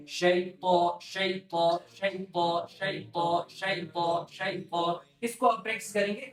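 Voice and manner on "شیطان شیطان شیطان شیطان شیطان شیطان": شیطان repeated evenly as a practice drill. Shown as a demonstration. Teacher drilling the word for practice. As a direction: say شیطان over and over. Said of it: "اس کو آپ کو ایسے ہی practice کرنا ہے".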